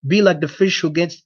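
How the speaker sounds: ordinary delivery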